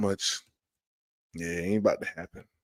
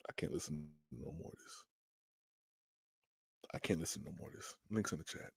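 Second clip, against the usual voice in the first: despairing mutter; 7 semitones lower, 19 dB quieter, faster than usual. Real, not acted.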